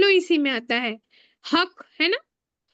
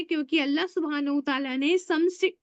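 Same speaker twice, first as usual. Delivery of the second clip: ordinary talk, nothing marked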